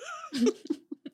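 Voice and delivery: shrieking giggle; high squeal of laughter. Real, not acted. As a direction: burst into a squeal. Laughs mid-joke.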